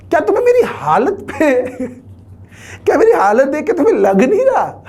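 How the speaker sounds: high, laughing lament about his own state. Real, not acted. Teacher laughing to students about his marriage, high and laughing. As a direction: laugh it off, voice lifting.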